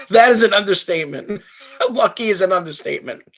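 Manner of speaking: laughing it out, voice up high. Real, not acted. Laughing as he agrees with the host. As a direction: laugh through the line.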